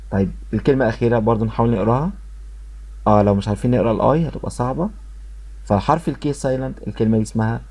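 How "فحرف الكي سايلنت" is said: ordinary talk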